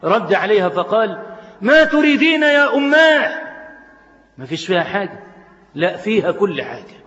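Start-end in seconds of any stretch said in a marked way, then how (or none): 1.6-3.3 son answers much higher and louder; raised voice. Acted. Son answers his mother louder than her voice. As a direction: answer back a bit too loudly. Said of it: "فرد عليها بصوت أعلى من صوتها".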